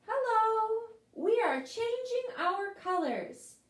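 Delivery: lightly voiced leaves. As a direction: leaves proudly changing colors, high. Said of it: "says the little person".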